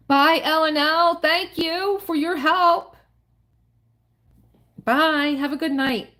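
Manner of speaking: cheery goodbye. Real, not acted. Signing off to helpers.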